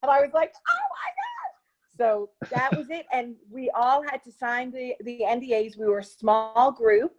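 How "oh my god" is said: high, slow, breathy-free squeal of disbelief; reliving the shock of landing the part; stunned gasp. Retold or imitated. Actress retelling her reaction to being cast, high slow disbelief. Hearing about the secret Hatchet role.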